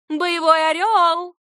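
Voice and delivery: high, loud, drawn-out cheer; болельщицкий клич. A narrator's reading. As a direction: belt out a proud rival team cry. Ответный клич болельщицы Оберна. (протяжный громкий клич болельщицы)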